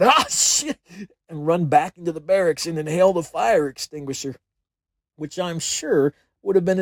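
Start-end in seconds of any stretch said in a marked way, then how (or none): 0.0-0.8 high, loud outburst; mock scream. A narrator's reading. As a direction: cry it out high and loud, as if choking on smoke. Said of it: "cough and scream out".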